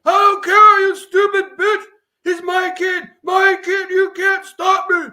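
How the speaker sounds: furious high, loud, slow screaming; shouting tirade. Acted. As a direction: rage at her across the room. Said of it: "A shouting tirade erupted".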